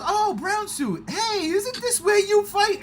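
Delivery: playful Deadpool taunt. Retold or imitated. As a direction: tease him with a sing-song. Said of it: "And he goes".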